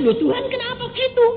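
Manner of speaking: sudden high-pitched protest to God; a startled, pleading objection; surprised protest. Retold or imitated. Speaker protests to God in prayer, startled and high.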